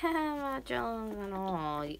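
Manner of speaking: slow, high, drawn-out protest; exaggerated, unconvincing "not jealous" whine; mock denial of jealousy. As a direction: stretch it out in hammed-up jealousy. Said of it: "like, more jealous of".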